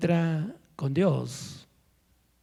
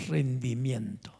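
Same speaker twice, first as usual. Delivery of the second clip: "rendimiento" slow, soft and lower, drawn out; hushed slow; 6 semitones lower, 6 dB quieter, slower than usual. Shown as a demonstration. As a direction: breathe "rendimiento" out low and slow. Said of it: "Digan conmigo".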